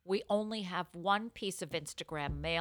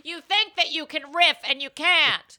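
mock scolding; 8 semitones higher, 9 dB louder than usual.

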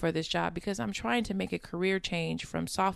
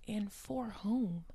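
whispering; 4 semitones higher, 5 dB quieter, slower than usual. Real, not acted. Self-questioning aside, whispered.

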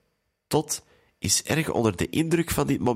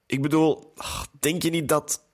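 higher-pitched, understated tearful upset; mildly tearful; 5 semitones higher than usual. A narrator's reading. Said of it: "zegt Sonja met tranen in haar ogen".

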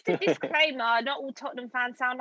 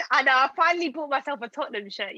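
usual speaking voice

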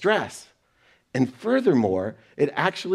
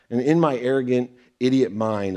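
ordinary delivery

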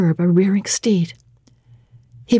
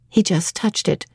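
ordinary delivery, nothing marked

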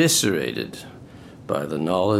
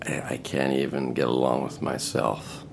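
low bitter mutter; 9 semitones lower, 6 dB quieter than usual. Acted. Ivan's bitter reply.

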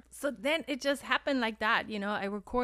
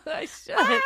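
laughing; 9 dB louder than usual. Real, not acted. Laughing agreement.

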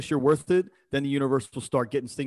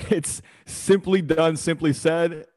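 slow, louder and higher, still laughing; 4 semitones higher, 5 dB louder, much slower than usual. Real, not acted. Guest laughing as he explains his motto to the host. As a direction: push the words out through laughter.

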